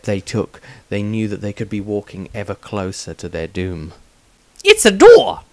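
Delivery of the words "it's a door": high excited squeak, not hushed; a bright excited blurt; mild excitement, meant to be quiet; eager exclamation. A narrator's reading. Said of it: "as quietly as he could".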